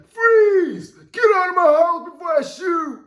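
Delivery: high frantic shouting at an intruder; frightened homeowner screaming at a burglar; scared shout. Pretend. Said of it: "Nah, they want me".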